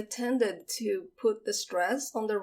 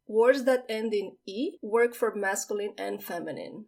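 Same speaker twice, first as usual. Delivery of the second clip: usual speaking voice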